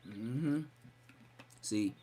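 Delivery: ordinary talk, nothing marked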